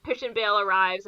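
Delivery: usual speaking voice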